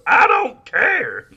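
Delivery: high, loud, slow yell; mock Tommy Lee Jones line as John C. Reilly. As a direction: stretch the line out like a goofy actor. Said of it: "I'm screaming Tommy Lee Jones lines as John C. Reilly".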